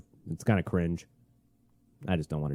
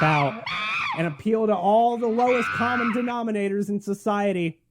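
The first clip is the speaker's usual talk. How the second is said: ranting shout; 12 semitones higher than usual. Real, not acted. Ranting climax.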